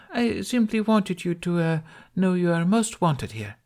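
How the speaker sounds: courtly, over-sweet welcome; empress's voice. Acted. Empress assures Hiccup he's welcome.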